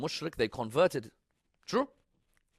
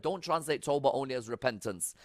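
ordinary talk

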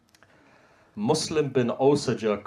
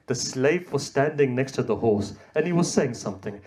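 usual speaking voice

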